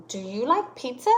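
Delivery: "pizza" lifting up at the end, then a pause; rising question tune that stops on "pizza" for an answer; questioning rise. Shown as a demonstration. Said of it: "our voice goes up and then it pauses".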